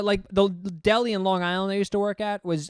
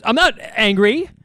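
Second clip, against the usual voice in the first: loud mock-angry protest, higher pitched; angry denial; 5 semitones higher, 8 dB louder than usual. Coworker denying it to the calm arguer, angry. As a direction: snap back loudly in hammed-up anger. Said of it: "You sound angry".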